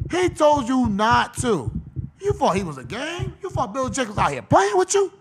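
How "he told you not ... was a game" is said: normal talking voice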